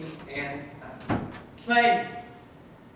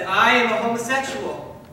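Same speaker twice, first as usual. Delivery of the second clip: louder, declaring it outright; retold bold declaration; 3 semitones higher, 7 dB louder than usual. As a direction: announce it firmly, as a retold confession. Retells declaring himself to principal.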